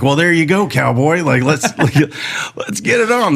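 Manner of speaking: egging on. Retold or imitated. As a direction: fire up the rookie with a rowdy cheer. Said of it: "this other guy's like".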